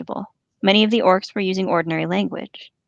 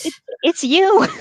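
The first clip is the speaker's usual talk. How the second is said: excited; 10 semitones higher, 5 dB louder than usual. Real, not acted. Laughing at the orcs' hypocrisy.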